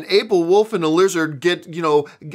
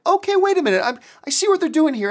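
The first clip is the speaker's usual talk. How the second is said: sudden realization; 9 semitones higher, faster than usual. Retold or imitated. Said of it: "I'm like".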